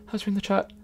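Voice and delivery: quiet. Real, not acted. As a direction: drop it to a quiet mutter. Repeats it after the shout.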